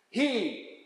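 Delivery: ordinary talk, nothing marked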